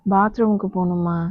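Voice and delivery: ordinary talk, nothing marked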